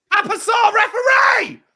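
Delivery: high, loud yell at the ref; shouting at referee. Real, not acted. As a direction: scream for the referee. Yelling at the referee.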